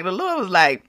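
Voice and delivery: ordinary delivery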